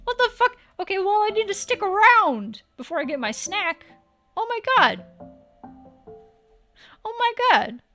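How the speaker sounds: normal talking voice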